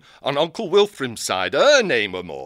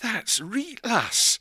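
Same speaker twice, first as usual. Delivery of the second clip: put-on higher, softer, awed old owl; amazed old owl; 7 semitones higher, 3 dB quieter than usual. Old owl marvelling at the fortune teller. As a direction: marvel at it like a simple old bird.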